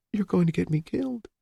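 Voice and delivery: high, soft and defeated; hopelessly. Acted. Amelia despairs to Richards, high and soft. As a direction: give up as you say it. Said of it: "she said hopelessly".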